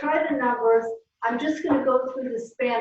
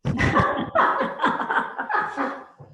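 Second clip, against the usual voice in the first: high laugh. Real, not acted. Teacher laughing at fun remark.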